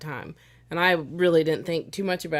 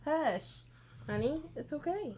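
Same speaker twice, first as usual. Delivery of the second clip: soothing; 6 semitones higher, 12 dB quieter than usual. Real, not acted. Soothing the dog.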